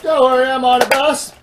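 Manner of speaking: very high, very loud shout. Acted.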